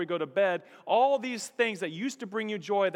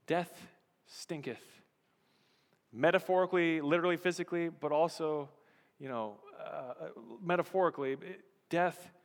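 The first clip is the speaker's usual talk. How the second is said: ordinary talk, nothing marked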